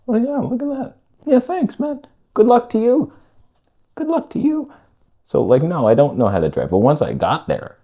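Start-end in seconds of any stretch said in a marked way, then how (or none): 0.1-4.7 put-on high voice, imitating an exchange; high-pitched mock politeness. Driver and helpful guy parting at the window, high mock politeness. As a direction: act the thanks out way up high.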